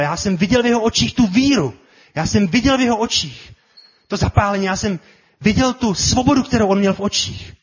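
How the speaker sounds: high, loud, fervent quote; impassioned testimony. Acted. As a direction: declare it with rising conviction, voice lifted. Acted quote of an inspired king.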